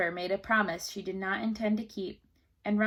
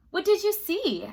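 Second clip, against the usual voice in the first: queen asking; 9 semitones higher, 5 dB louder than usual. A narrator's reading. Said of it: "asked the queen".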